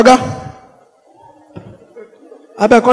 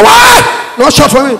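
indignant yell; 4 semitones higher than usual. Retold or imitated. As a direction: shout it like a startled cop. Indignant policeman quote.

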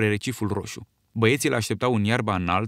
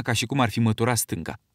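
ordinary delivery, nothing marked

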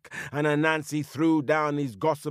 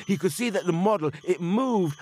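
usual speaking voice

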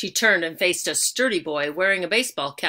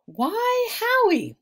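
high, fond grandmother voice; 5 dB louder, much slower than usual. A narrator's reading. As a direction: lift it up like a doting grandma. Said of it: "said his grandmother".

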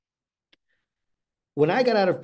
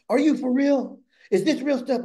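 much higher, louder, bursting with pent-up energy; excited yelling; 10 semitones higher, 4 dB louder than usual. Retold or imitated. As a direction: blurt it out, overflowing with excitement. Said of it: "I started yelling at him".

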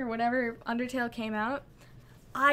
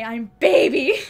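shouting; 14 dB louder than usual. Real, not acted. Jokey meltdown over chat.

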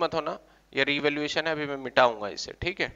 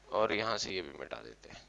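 very soft, low murmur; quiet aside under the breath; muttering while erasing; 8 semitones lower, 11 dB quieter than usual. Real, not acted. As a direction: say it to yourself as you wipe.

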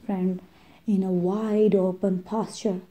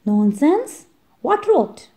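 much higher scoffing exclamation; indignant cry; 7 semitones higher, 3 dB louder than usual. Acted. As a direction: scoff it out in disbelief. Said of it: "cried the, the others".

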